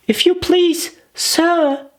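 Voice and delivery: put-on a small, shy girl's plea; timid. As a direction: ask politely and nervously. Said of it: "she began in a low, timid voice".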